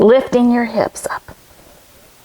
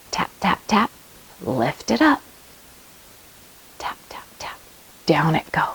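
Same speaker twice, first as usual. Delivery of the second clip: very soft whispered spell; 6 semitones lower, 8 dB quieter than usual. Pretend. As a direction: hush the magic.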